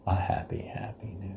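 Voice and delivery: whispering. Pretend. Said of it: "Not just a happy new year".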